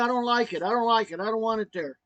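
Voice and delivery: high, insistent complaint; imitated protest. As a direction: act out her agitated refusal. Wife protesting about the IV.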